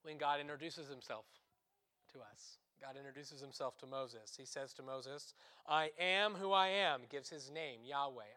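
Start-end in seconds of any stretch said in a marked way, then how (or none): 5.7-7.0 voice of God. Acted. God speaking to Moses.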